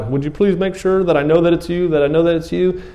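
high, needy mock plea; anxious pleading. Preacher acts Gideon asking God for a sign, anxious pleading.

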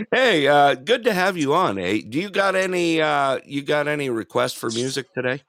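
bright, lifted DJ voice greeting a caller; a host welcoming someone on air; upbeat radio host. Real, not acted. Radio host greets a caller on air, upbeat and lifted.

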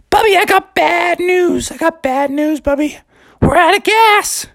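very high little bear voice, worried; high-pitched cartoon bear breaking bad news; squeaky Junior Bear.

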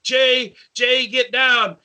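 high, slow, frantic plea to get down; panicked pleading. Retold or imitated. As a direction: beg him off the roof in panic. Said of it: "Brian's freaking out".

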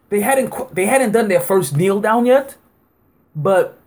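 ordinary talk

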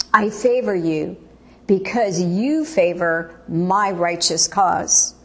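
usual speaking voice

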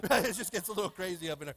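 ordinary delivery

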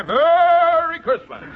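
high, drawn-out Santa call thrown back in scorn; fed-up Santa quitting the store; mocking Santa boom. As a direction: sneer out the holiday greeting.

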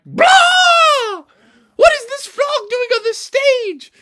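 very high, loud shriek; scream, then screech the outraged question; alarmed scream. Acted. Startled actors see a frog on stage, shrieking alarm.

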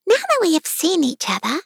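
high-pitched put-on unicorn voice. A narrator's reading. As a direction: speak as a lofty storybook creature.

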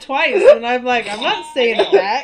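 high, slow, laughing through it; giggling while protesting. Real, not acted. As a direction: tells friends he won't say it back, laughing. Laughing retelling the trap.

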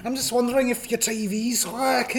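loud high pub aggro; aggressive high shout. Acted. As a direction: square up like a drunk bloke. Aggressive drunk mate.

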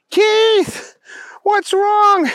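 very high-pitched calling out; alarmed yell. A narrator's reading. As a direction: cry out worriedly to your buddy. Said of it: "Booger yelled".

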